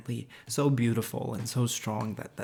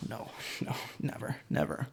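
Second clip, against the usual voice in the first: laughing whisper; 10 dB quieter than usual. Acted. Frithjof refusing, laughing.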